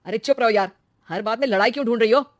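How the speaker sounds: loud, quick scolding; annoyed husband. Acted. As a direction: shut her up impatiently. (झल्लाया हुआ पति)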